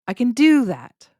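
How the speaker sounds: loud, high "do", quick "can"; stress on DO. Shown as a demonstration. Teacher tests listeners with a yes example, stress on "do". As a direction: punch the verb "do". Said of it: "where I put the stress".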